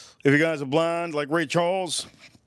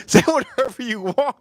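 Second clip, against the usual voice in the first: laughing quote; 9 semitones higher, 4 dB louder than usual. Retold or imitated.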